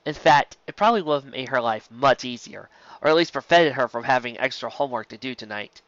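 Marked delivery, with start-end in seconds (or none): none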